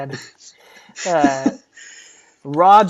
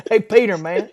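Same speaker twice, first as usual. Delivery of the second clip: higher, drawling dude voice; Office Space neighbor; 4 semitones higher than usual. Retold or imitated. Co-host mimicking the Office Space dude, drawling impression. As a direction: do a laid-back movie-neighbor impression. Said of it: "the dude from Office Space".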